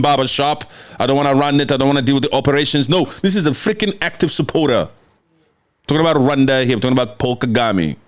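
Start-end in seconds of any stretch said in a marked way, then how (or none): none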